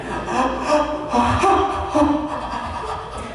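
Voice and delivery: mock hyperventilation; fearful gasping. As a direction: pant rapidly, imitating a panic attack. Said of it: "that's what it sounds like".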